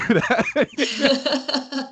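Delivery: high, slow laugh through it; amused disbelief. Real, not acted. Laughing at California.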